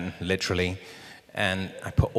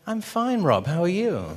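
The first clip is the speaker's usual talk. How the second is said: very high-pitched fake cheeriness; lying brightly; 10 semitones higher than usual. Actor lying to his director, high fake-casual voice. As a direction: overdo the innocent "fine", pitch way up. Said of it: "lying through my teeth".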